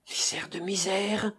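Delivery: soft, anguished lament; anguished groan. A narrator's reading. Florent hearing his pursuers arrive, soft anguished lament. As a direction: moan it in despair. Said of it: "agonisa monsieur Florent".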